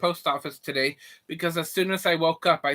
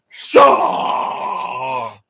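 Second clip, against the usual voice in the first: loud shout; 6 semitones lower, 9 dB louder than usual. Acted. Story climax outburst.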